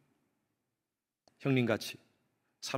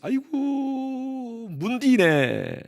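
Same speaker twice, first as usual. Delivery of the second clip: Gyeongsang dialect; 9 semitones higher, much slower than usual. Retold or imitated. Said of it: "경상도에서는 그렇게 얘기했어요".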